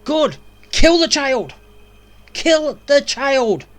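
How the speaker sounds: ordinary talk, nothing marked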